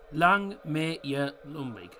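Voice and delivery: "Lang may your Lumbeek" drawn out slowly with a touch of Scots; slow Scottish toast. Shown as a demonstration. Streamer slowly trying out a Scottish toast for chat. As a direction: savour each word of "Lang may your Lumbeek" like a proper Scot. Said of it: "That's what it is".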